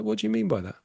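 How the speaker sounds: high, surprised what do you mean; puzzled question. Retold or imitated. Scene: friend says the client came from him, puzzled.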